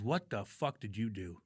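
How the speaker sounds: low, slow gruff dad on the phone; angry dad. Retold or imitated. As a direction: growl it like a furious father. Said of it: "and he goes".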